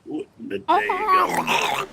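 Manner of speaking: What in the day ago in a high squeaky voice; high squeaky old man. Pretend. Repeat in high put-on voice.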